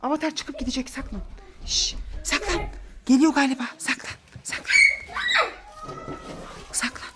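soft hushed urging; whisper-quiet, keep them hidden. Acted. Mother hushing kids while hiding, soft urgent hush.

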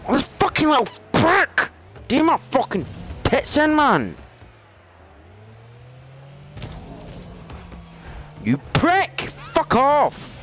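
high, ranting yell; furious shout. Real, not acted. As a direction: blow up at the other player. Raging after being beaten again.

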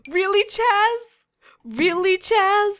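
high, loud, incredulous Really Chaz; exasperated disbelief. Real, not acted. Disappointed by last time capsule.

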